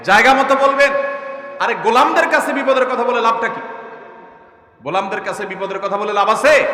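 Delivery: loud, high rebuke; fiery preaching. Real, not acted. Preacher rebuking the congregation, loud and high. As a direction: thunder it at the crowd.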